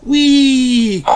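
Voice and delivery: high gleeful whee; excited cry. Acted. Peppa scooting along, squealing with delight. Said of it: "cries Peppa".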